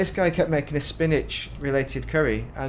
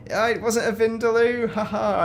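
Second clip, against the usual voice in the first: higher, louder, laughing tease; mock teasing laugh; 11 semitones higher, 6 dB louder than usual. Retelling the waiter laughing at him, mock teasing tone. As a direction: rib the customer with a mock chuckle. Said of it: "and laughed at me".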